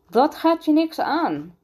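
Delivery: high, indignant angry voice; cross, high-pitched rebuff; angry retort. A narrator's reading. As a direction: snap it back crossly, pitch jumping up. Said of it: "siste zijn vader kwaad".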